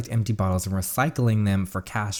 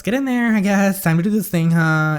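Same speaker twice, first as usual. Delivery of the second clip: high, loud, held-up pitch; loud high-pitched rallying call; hyped-up; 11 semitones higher, 7 dB louder than usual. Acted. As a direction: pump everyone up, high and loud. Hyping up before entering the store.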